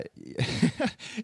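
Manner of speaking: high breathy nervous giggle; nervous laughter. Real, not acted. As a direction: laugh off the nerves. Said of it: "I'm laughing because I'm, I'm nervous laughing".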